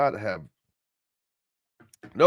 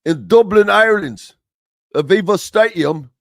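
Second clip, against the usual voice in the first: a loud, hammed-up Irish accent that misses; mock Irish accent, deliberately poor; bad Irish accent; 5 semitones higher, 9 dB louder than usual. Radio host hypes a game in Ireland, in a bad Irish accent. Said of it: "I don't think that's an Irish accent".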